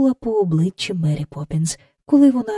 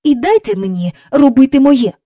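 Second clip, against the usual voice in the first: loud, raised-pitch irritated demand; cross; 6 semitones higher, 7 dB louder than usual. A narrator's reading. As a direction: crossly demand to be left to your work. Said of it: "сердито сказала вона".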